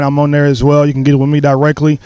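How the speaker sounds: ordinary talk, nothing marked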